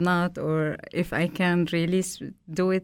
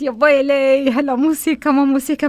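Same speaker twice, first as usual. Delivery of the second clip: higher, louder mock panic; dismay; 5 semitones higher, 7 dB louder than usual. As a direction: throw up the hands in mock alarm. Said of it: "قلت".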